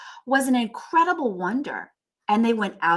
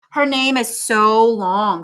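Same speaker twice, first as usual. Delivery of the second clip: slow mocking complaint; 2 semitones higher, 9 dB louder, slower than usual. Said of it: "said Jo".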